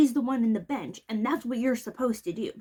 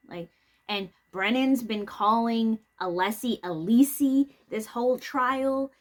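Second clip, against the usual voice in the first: ordinary talk, nothing marked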